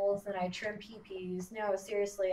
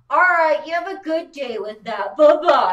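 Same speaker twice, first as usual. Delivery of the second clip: loud, higher sign-off, retold; mock polite dismissal; 5 semitones higher, 12 dB louder than usual. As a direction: cut them off with a loud mock-polite goodbye. Retold dismissive goodbye before hanging up.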